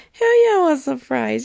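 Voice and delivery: mock gleeful customer ordering at three A M; hammed-up eager customer. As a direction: cheer for the fries like a starving late-night customer. Customer eagerly accepting fries.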